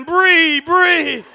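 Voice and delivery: high, loud wailed name; mock crying father. Father on the couch calls to daughter, mock crying. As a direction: call out in hammed-up anguish. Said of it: "I'm on the couch crying".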